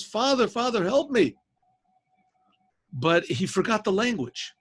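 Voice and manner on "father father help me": high pleading call for help; raised, urgent plea; acted yell. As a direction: cry out to a father far above, pitched well up. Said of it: "he yells".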